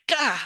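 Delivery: high, recoiling "gah"; acted cry of alarm; startled "gah". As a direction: blurt a mock-startled gasp word. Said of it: "you're instantly like".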